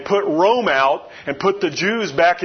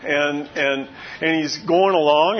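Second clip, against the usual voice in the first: usual speaking voice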